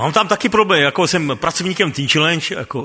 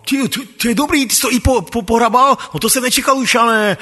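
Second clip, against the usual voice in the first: high, pleasantly surprised boss; surprised praise; 6 semitones higher than usual. Retold or imitated. Jirka finds the grass raked, surprised and pleased. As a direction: sound caught off guard and pleased.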